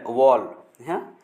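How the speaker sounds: वॉल said slowly, drawn out; वॉल read slowly for learners; slow model word. Shown as a demonstration. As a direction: spell out वॉल slowly with a long o. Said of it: "वॉल लग रहा है".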